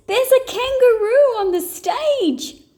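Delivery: high, loud alarmed cry; startled yell. A narrator's reading. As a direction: shout in alarm at the intruder. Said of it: "yelled the dancers".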